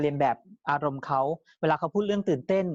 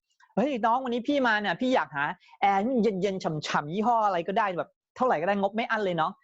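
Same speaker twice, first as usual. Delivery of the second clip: higher, hearty put-on customer voice; loud, open customer; 4 semitones higher than usual. Customer hailing salesman for an air conditioner, loud and open. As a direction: call it out freely, pitched up. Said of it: "ลูกค้าพูดเสียงดัง".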